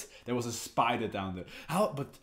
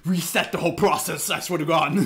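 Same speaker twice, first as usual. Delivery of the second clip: loud, heated threat at the screen; angry outburst; 4 semitones higher, 7 dB louder than usual. Real, not acted. Yelling at the anime villain in anger. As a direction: snap at the character, fed up.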